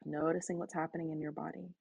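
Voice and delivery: usual speaking voice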